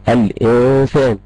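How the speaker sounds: الإنسان slowly, with the n held; slow demonstration of the n in الإنسان; sounded still noon. Shown as a demonstration. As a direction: stretch the vowelless n in الإنسان. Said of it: "موجودة قدامي وبنطقها".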